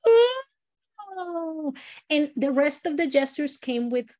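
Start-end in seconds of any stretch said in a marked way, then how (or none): 0.1-1.7 high, drawn-out monster "oh"; wide-mouthed monster. Shown as a demonstration. Storyteller shows the monster opening his mouth a lot, wide-mouthed monster voice. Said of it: "open his mouth a lot".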